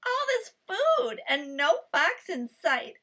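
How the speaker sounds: lightly voiced happy guinea pig; Kui delighted. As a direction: savor the triumph out loud. Kui gloats over the food.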